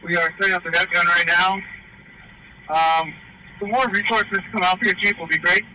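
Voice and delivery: loud, high shout through a mask; yelling over the fire into the radio; firefighter shouting through mask. Real, not acted.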